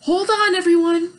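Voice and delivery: high, loud warning shout; high loud cry to hold on. A narrator's reading. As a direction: yell to brace everyone. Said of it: "Baby shouted".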